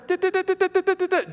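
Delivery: high-pitched rapid nagging babble, imitated; wordless high "da-da-da" chatter; mock chirping. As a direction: imitate a neighbour chirping away in complaint. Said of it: "chirping at me as she's walking".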